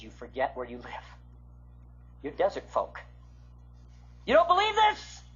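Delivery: a hint of a stern God; voicing God. God reminding Westerners they are desert folk, lightly stern. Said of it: "And God says".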